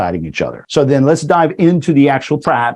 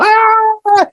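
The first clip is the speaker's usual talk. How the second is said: very high-pitched mock scream; high "aah" of road rage, not full volume; mock angry yell. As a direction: imitate an outraged driver's squeal. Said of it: "you're gonna yell at them".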